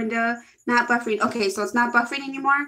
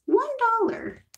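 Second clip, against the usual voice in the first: high squeaky mock boast; playful emphasis; 12 semitones higher than usual. Real, not acted. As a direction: squeak it out proudly. Stressing her cheap lipstick.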